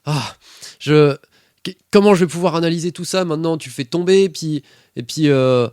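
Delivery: high, loud exasperated tirade; annoyed rant. Acted. Eddie berating Ray over the ruined evidence, angry rant. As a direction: rant on in a fluster, barely finishing sentences. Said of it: "là, ça m'énerve".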